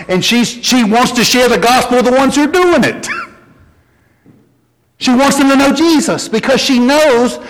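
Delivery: high, urgent, climbing preacher's call; impassioned preaching, pitch soaring; preaching at full force. Acted. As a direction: build the sermon to its peak. Preaching climax, pitch rising high.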